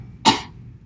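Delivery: sick cough. Acted. Sick tribesman.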